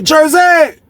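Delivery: high shout across the cell block; calling out. Retold or imitated. Calling out to a friend.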